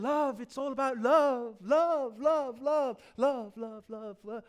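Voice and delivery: mock chant, starting full and trailing away; mimicking love-obsessed people. Preacher mimicking people who go around saying love, mocking and fading. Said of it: "people go around saying".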